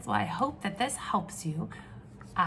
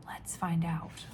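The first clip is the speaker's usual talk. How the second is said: hushed excited whisper; 3 semitones lower, 3 dB quieter than usual. Real, not acted. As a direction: lean in and whisper it. Teacher whispers to children.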